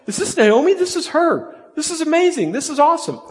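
high, excited gushing greeting; excited friends. Retold or imitated. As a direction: gush over her like thrilled friends. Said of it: "all of her friends are like".

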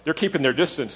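normal talking voice

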